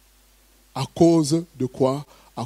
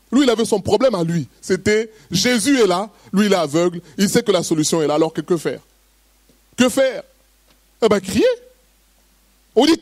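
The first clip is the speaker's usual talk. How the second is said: high, urgent preacher's voice; preaching; 5 semitones higher than usual. Acted. Pastor urges the congregation to stop fearing others, fervent preaching. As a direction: press the congregation with rising fervour.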